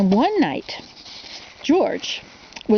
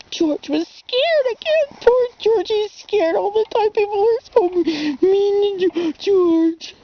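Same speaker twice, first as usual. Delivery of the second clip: high, slow, pitying tone; 9 semitones higher, slower than usual. A narrator's reading. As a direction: feel sorry for poor George. Sympathy for George.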